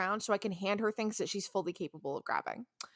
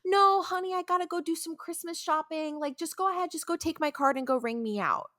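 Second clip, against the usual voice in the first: happy customer; 7 semitones higher than usual. Retold or imitated. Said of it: "she's very happy the whole time".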